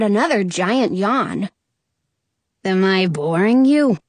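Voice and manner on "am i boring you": lower, irked genie voice; lightly voiced grumpy genie; unimpressed genie. A narrator's reading. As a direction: ask it with mild disdain. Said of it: "one eyebrow raised".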